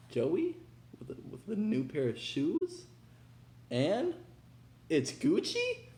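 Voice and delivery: high, rising, incredulous squeak; mock doubting viewer. Imagined viewer doubting the Gucci pickup, high incredulous squeak. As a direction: play a skeptical fan who can't believe it. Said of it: "you might be saying".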